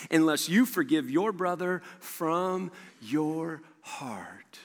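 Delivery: slow down and fade to a whisper; slow, grave, dying-away delivery; solemn warning. Acted. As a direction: let the last word drop almost to nothing. Solemn warning, slowed to near whisper.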